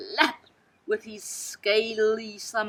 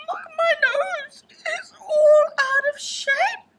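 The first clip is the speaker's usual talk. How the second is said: high, tearful, wobbly whimper; crying; 6 dB louder than usual. A narrator's reading. Elephant's child crying to snake about his nose. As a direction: sob it out.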